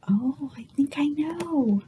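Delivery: conspiratorial whisper. Acted. Librarian whispering a hint to the children. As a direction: let them in on a secret.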